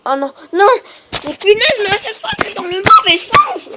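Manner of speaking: very high, loud outburst; real high-pitched gamer rant; exasperated screech. Real, not acted. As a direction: screech in frustration at the game. Keeps going the wrong way.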